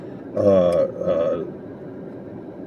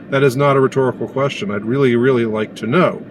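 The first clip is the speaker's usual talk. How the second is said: normal talking voice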